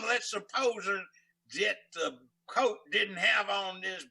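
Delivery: loud, slow, drawn-out mock-formal indignation; nasty courtroom sparring. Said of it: "the judge and the lawyer were getting nasty".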